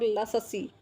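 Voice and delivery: second लस्सी as la-sa-see, full middle sa; पूरा स. Shown as a demonstration. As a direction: split the double s of लस्सी with a vowel. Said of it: "अंतर है". (लस्सी को ल-स-सी बोलकर)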